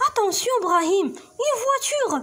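acted warning shout; warning call. Character shouts warning in text.

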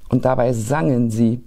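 ordinary delivery

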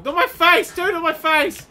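high, loud yelping; panicked protest. Real, not acted. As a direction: beg the attacker off loudly. Being hit in the game.

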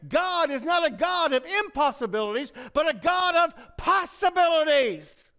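loud, high, ringing preacher's cry; full-force preaching. Acted. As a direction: build it to a soaring shout.

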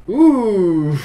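high drawn-out "ooh", tailing into a laugh; impressed hooting. Real, not acted. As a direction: hoot at what you've just seen. Reacts as the tournament starts. (« ouh » impressionné et rieur)

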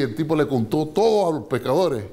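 usual speaking voice

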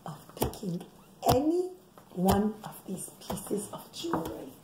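dropped to a whisper; hushed. A narrator's reading. Mother hushing the secret to her children. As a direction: hush it down like a secret.

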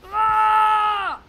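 long, high, very loud yell; drawn-out high shout; loud scream. Real, not acted. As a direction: scream out at full volume. Leaps into action in the game.

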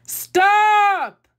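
high yelled roar of a lion; lion's yell. A narrator's reading. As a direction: bellow it in frustration. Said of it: "yelled the lion".

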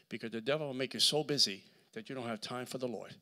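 ordinary delivery

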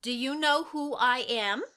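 ordinary talk

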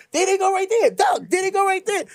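high, excited shout; high excited yell across the lot; fans calling out. Retold or imitated. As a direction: point them out to everyone, yelling. Fans pointing, shouting at targets.

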